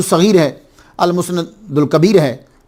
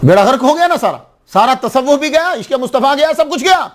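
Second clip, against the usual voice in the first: each line higher, ending in a cry; climbing lament; 7 semitones higher than usual. Real, not acted. Preacher to congregation, rising outraged lament.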